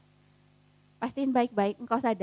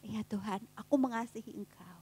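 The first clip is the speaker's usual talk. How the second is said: lower tone; 6 semitones lower, 10 dB quieter than usual. Acted. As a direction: lower and much softer, losing confidence. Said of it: "Mulai, mulai nadanya rada, rada rendah ya".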